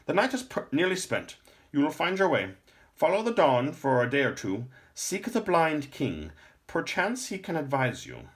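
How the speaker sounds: put-on high, loud old man sending him away; urgent old lore master. A narrator's reading. Lore master sends Jason off to seek the blind king, high and loud. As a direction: send the boy off into the dawn with grave urgency.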